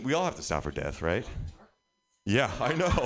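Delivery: ordinary talk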